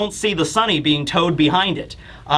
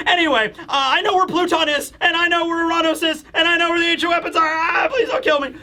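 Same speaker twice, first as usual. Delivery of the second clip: much higher, laughing, scared babble; pitched-up giggly panic, begging for mercy; nervous laughing plea; 11 semitones higher than usual. Retold or imitated. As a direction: blurt out secrets to save your skin. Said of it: "it's like".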